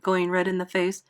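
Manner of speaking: ordinary delivery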